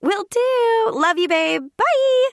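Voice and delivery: very high, chirpy phone goodbye; high-pitched sign-off. A narrator's reading. Sister ending a phone call, high chirpy goodbye. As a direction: toss out loud, squeaky farewells.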